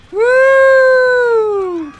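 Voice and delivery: long, loud high scream. Real, not acted. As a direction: scream as the helicopter drops. Helicopter falling. (uzun, tiz ve yüksek çığlık)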